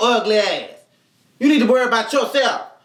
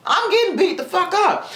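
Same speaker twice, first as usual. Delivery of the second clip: laughing; 9 semitones higher than usual. Real, not acted. Laughing while retelling the beating, voice pitched up. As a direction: laugh through the words, pitch up.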